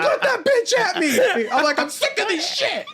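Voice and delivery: high, slow, laughing defiance; mock defiant yell. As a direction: dare him through laughter.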